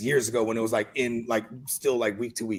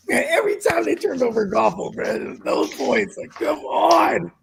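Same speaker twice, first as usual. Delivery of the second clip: real frustrated cry, higher, drawn out on "come on"; exasperated outburst; 5 semitones higher than usual. Real, not acted. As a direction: vent it high and stretched, fed up. Venting over turnovers and lost points.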